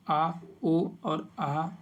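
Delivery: slow, separate "आ, ओ और आहा"; धीरे-धीरे स्वर उच्चारण; vowels spelled slowly. Shown as a demonstration. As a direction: sound out "आ, ओ और आहा" one at a time, slowly. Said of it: "अर्ध विवृत में ए".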